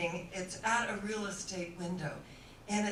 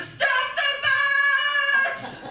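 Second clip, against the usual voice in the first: long, high yell; acted shout; 10 dB louder, much slower than usual. Frances to the bus driver, drawn-out shout. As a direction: holler it out high, dragging the words. Sudden outcry to halt bus.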